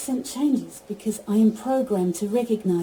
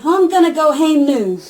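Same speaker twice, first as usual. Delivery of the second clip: loud, high-pitched Geordie; Geordie accent; 7 semitones higher, 8 dB louder than usual. Shown as a demonstration. Assistant demonstrating Geordie on request, loud Geordie accent. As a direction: belt it out in broad Geordie. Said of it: "in Geordie, can you say".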